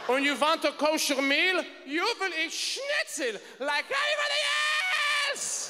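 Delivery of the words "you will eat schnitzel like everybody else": stern German command. Retold or imitated. Stewardess mocks kosher request.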